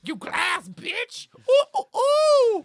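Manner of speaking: loud, high, drawn-out monkey hoots. Acted. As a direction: hoot and taunt like a flailing ape. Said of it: "flails his arms in a monkey-like fashion and goes".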